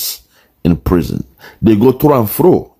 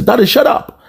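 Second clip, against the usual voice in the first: much higher, petulant mock snap; mock angry kid; 14 semitones higher than usual. Friend retelling how the boy silenced his dad, mock angry squeal. Kid snapping at yawning father.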